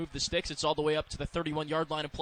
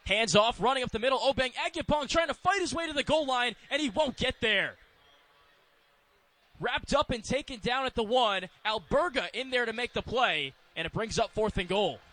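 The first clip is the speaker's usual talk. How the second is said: raised pitch, building then easing down; excited play-by-play; 6 semitones higher than usual. Real, not acted. Announcer calls a stopped run at the goal line, excited play-by-play. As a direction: call the goal-line push with urgency.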